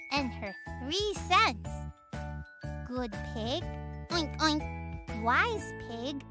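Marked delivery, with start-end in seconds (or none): none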